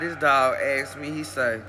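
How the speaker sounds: ordinary delivery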